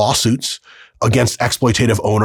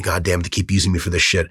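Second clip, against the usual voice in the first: pissed-off grumble; 6 semitones lower, 4 dB quieter, faster than usual. Pretend. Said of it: "you're just, like, pissed".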